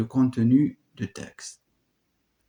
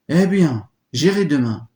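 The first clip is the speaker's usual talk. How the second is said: loud protest; 4 semitones higher, 7 dB louder than usual. Acted. Ismène protests.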